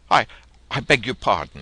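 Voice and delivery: put-on embarrassed young man apologizing to the girl, flustered; gulped apology. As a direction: catch yourself and blurt it out. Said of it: "he gulped".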